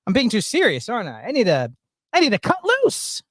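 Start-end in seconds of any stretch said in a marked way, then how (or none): 2.1-3.2 sudden high burst of resolve; excited. Real, not acted. Streamer urging himself to loosen up, excited. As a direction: pump yourself up to cut loose.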